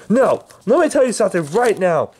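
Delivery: furious. Acted. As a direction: lay into him in rage. Angry threat.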